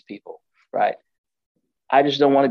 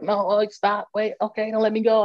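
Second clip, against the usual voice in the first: high-pitched imitation of a woman pleading; 9 semitones higher than usual. Retelling the pill lady begging him to release her arm, high pleading voice. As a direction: mimic someone begging, voice pitched up. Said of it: "she's begging me".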